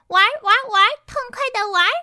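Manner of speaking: high, loud, fast excited chant; shouting. A narrator's reading. The cloned Pinkies clamor to play, shouting excitedly. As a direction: yell it like a gleeful mob. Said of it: "大叫着".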